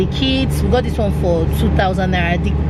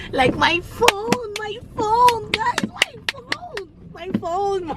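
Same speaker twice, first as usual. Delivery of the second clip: thrilled squeal; 14 semitones higher, 4 dB quieter, much slower than usual. Real, not acted. Thrilled about a new phone.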